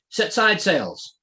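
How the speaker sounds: a captain's drawn-out shout; shouted order. A narrator's reading. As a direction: bellow the order slowly to the crew. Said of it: "he shouted".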